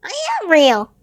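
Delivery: high, loud, insistent toy rabbit; the little rabbit's voice. A narrator's reading. Toy rabbit pleading with the wild rabbits, high and insistent. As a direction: insist earnestly like a small creature. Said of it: "said the little rabbit".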